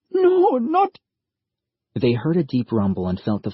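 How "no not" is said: put-on much higher, louder girl's voice; a scared child begging, breaking off; young girl pleading to keep her family together; frightened girl. A narrator's reading. Girl protests.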